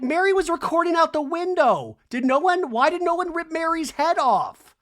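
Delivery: high, loud imitation of indignation; mock outrage. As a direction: wail the accusation in exaggerated protest. Acting out Brittany's outraged accusation.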